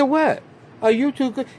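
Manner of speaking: hammed-up high-pitched mom sneering at Holly; mocking mom voice. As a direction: scoff at her in a high mocking voice.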